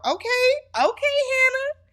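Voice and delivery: very high, rising "okay, okay"; high-pitched approval. Real, not acted. Host backs Hannah for blaming the guy, high-pitched approval. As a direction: cheer her on in a squeaky high voice.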